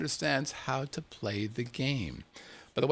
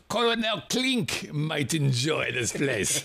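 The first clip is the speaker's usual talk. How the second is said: a light mock German accent, slow; slower than usual. Shown as a demonstration. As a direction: Colonel Klink German accent, lightly done. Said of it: "That was my best, uh, accent".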